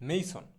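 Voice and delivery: মেইসন with a long gliding "ei" in the first syllable; corrected pronunciation. Shown as a demonstration. Teacher modelling the English for mason, মেইসন with an "ay" glide. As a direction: say মেইসন as "MAY-son". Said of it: "শুদ্ধ উচ্চারণটা হবে হচ্ছে".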